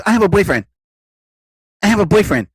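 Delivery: snappy repeated brush-off; quick brush-off. Retold or imitated. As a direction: fire it back twice, fast. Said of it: "she quickly goes".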